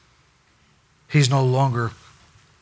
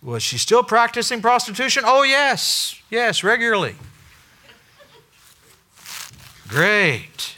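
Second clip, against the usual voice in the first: higher, bouncy back-and-forth; mock committee exchange; 11 semitones higher, 4 dB louder than usual. Committee questioning Hosea, mock higher voices. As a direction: play both sides lightly. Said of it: "pastor search committee".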